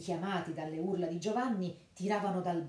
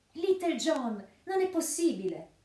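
high, loud astonished cry; surprised exclamation; 10 semitones higher, 7 dB louder than usual. A narrator's reading. Friar amazed to see his friend enter the cell. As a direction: gasp it out in disbelief. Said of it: "esclamò sorpreso Fra Tuck".

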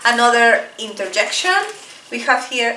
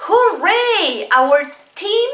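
high bright cheer; excited cheer; cheering hooray; 4 semitones higher, much slower than usual. Shown as a demonstration. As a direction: celebrate like a fan. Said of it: "For example".

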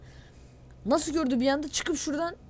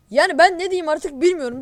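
loud, high fed-up rant; real exasperation, loud; exasperated; 5 semitones higher, 10 dB louder than usual. Real, not acted. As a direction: throw up your hands, fed up.

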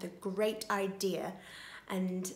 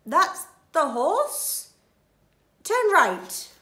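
thief voice; 7 semitones higher, 7 dB louder, slower than usual. Acted. Thief Len speaking.

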